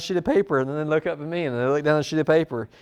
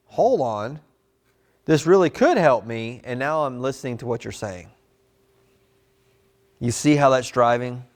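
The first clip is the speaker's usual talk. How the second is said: usual speaking voice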